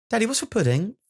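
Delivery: put-on high child's question. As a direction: ask high like a small kid. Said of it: "child asking dad".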